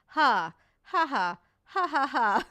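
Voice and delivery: mock slow sarcastic "ha, ha"; imitating her laugh. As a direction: copy the lady's dry laugh. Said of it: "the way this lady laughs".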